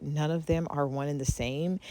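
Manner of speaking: usual speaking voice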